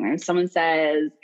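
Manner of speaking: ordinary talk, nothing marked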